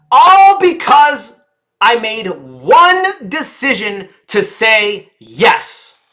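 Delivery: triumphant slow punchline; big declaration. Shown as a demonstration. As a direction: proclaim it to the back row. Demo punchline delivery.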